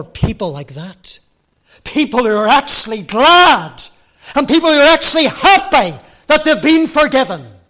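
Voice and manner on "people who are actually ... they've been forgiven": loud, high, impassioned preaching; fervent preaching. Acted. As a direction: lift the voice and preach it out. Preaching at full force.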